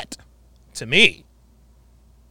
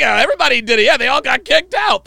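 mocking the guest; 8 semitones higher, 6 dB louder, faster than usual. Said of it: "He's like".

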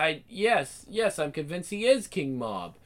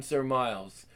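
ordinary talk, nothing marked

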